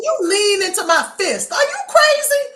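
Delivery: high, loud mock fury breaking into laughter; mock threat. Cohost mocking the kicker's lean into it line, loud mock rage. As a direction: threaten him, half joking, half yelling.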